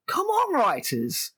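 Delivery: high, stretched-out cry of despair; exasperated plea. Real, not acted. Reviewer calling out the writers, exasperated. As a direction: drag it out, high and fed up.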